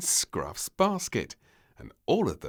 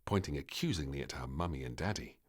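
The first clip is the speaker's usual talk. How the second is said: ordinary delivery, nothing marked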